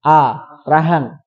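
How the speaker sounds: a held slow with the jaw wide open. Shown as a demonstration. Teacher showing the open jaw on fathah. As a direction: drop the jaw and hold the a. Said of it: "bibirnya gimana".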